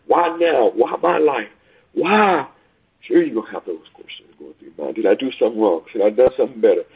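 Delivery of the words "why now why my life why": questioning why. Retold or imitated. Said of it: "the struggling of".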